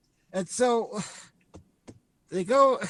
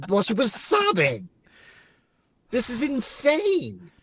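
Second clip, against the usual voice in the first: exasperated disbelief; 6 semitones higher than usual. Real, not acted. Host reacting to absurd plot.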